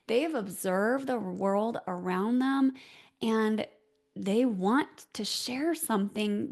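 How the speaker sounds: usual speaking voice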